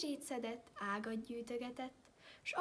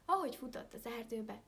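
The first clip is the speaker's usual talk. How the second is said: plain reading voice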